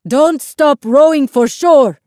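high, loud, slow drawn-out yell; urgent call over the waves. A narrator's reading. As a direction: yell orders to everyone in the boat. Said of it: "Isabel's grandfather yelled to everyone else".

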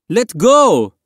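high, loud yelled order; yelling. A narrator's reading. Woman in the dinghy to the boy hanging on, yelling. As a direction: yell it down at someone below. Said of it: "yelled down at him".

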